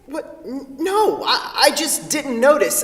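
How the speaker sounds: high, startled stammer; flustered denial. Acted. Kent caught staring by Sarah, stammers a startled denial. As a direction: caught off guard, stumbling to deny it.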